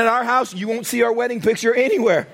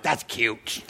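dry put-down; 10 semitones lower, 7 dB quieter than usual. Retold or imitated. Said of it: "and I'm like".

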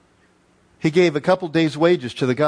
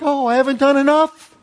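mock fretful lament; 8 semitones higher, 3 dB louder than usual. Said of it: "The bleeding hearts".

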